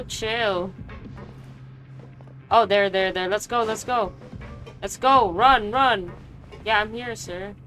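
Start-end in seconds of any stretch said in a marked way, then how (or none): none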